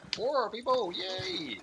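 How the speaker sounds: high, bright little cheer; excited cheer. Real, not acted. Host cheering four players joining this morning, excited. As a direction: celebrate the crowd with a squeal of yay. Celebrating posse size.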